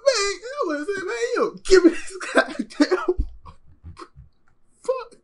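very high, loud ranting squeal; exasperated outburst. Real, not acted. Viewer ranting at the water offer, exasperated. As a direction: blurt it out high, breaking into laughter.

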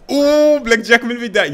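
loud, high shout of surprise; excited shout. Real, not acted. Gamer yelling at an instant blackjack. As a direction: yell it out at the lucky deal.

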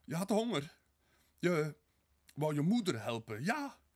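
loud, high, desperate outburst; high, urgent shout; crying out. A narrator's reading. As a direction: exclaim it at the top of the voice, grasping for a reason. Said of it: "tot ze uitriep".

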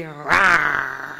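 a teacher showing angry with a playful growl. Shown as a demonstration. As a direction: growl like a grumpy bear for the kids. Acting angry for feelings lesson.

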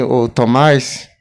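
ordinary talk, nothing marked

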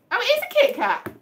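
high, loud burst of surprise; you just guessed it right; surprised delight. Real, not acted. Guessing items by touch.

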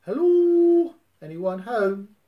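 very high, loud echoing call; the cave echoing Pooh's call. Acted. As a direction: echo the call back. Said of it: "a friendly voice answer back".

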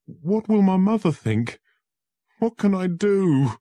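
high, loud, distressed; poor accused lawyer pleading; anguished fear. Acted. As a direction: cry out in despair. Poor man despairs.

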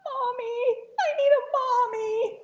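put-on very high, wailing baby-bird sobs; crying. A narrator's reading. Lonely little bird longs for a mother, crying. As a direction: whimper and wail like a lost chick. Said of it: "he started to cry".

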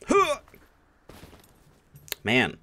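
usual speaking voice

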